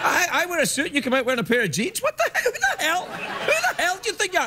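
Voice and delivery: high, mock-indignant ranting at the guest; mock outrage. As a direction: fake fury to stall for time. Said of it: "I'm just vamping".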